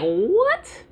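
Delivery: a high, stunned "What?"; mock astonishment. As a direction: act floored by the news. Amazed by God's revelation.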